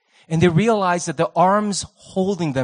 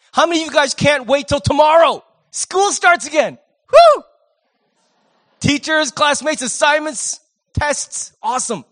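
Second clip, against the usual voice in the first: much higher, louder fake enthusiasm with a whooping cheer; mock excitement; 9 semitones higher, 7 dB louder than usual. Preacher mocking students' eagerness for school, higher and louder fake cheer. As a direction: ham up a sarcastic cheer.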